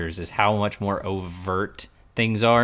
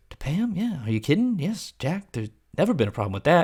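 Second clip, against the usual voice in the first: lightly voiced Greg; 7 semitones higher than usual. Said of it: "and he goes".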